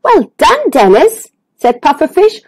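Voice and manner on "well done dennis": high, lilting congratulation; admiring praise. Acted. Pufferfish praises the brave little fish, admiring. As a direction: start high and praise gladly.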